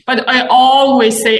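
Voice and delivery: normal talking voice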